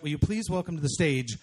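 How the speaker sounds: loud MC build to the name; big emcee welcome; stage introduction. Real, not acted. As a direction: announce the next act, punching the name. Host introduces act to the stage.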